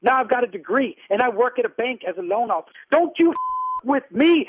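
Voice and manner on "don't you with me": loud, higher, real fury; raised voice, broken up for emphasis; angry warning. Real, not acted. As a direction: warn him off forcefully. Warns caller off in anger.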